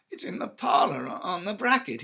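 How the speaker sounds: loud, high, near-shouted answer; alarmed. Acted. Husband answers his frantic wife, loud and alarmed. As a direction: blurt it out in shock.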